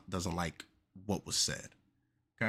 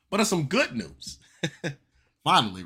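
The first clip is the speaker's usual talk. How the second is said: excited announcement; 7 semitones higher, 8 dB louder than usual. Real, not acted.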